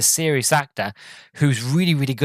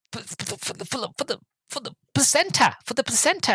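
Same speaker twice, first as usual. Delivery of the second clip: high, hushed start, stuttering into a squeak; flustered stammer; 11 semitones higher, 5 dB quieter than usual. Retold or imitated. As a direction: stammer out a desperate mock excuse. Retells a flustered liar inventing an excuse.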